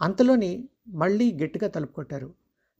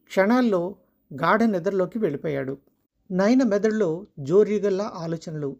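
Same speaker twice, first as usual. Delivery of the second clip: ordinary delivery, nothing marked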